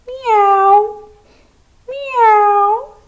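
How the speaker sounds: high, far-off kitten meow; cat meow. Shown as a demonstration. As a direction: squeak it out like a tiny cat. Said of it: "press and say".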